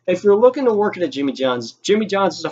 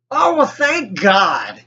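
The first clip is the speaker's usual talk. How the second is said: relieved sigh; 4 semitones higher, much slower than usual. Retold or imitated. Relief as Dan walks in.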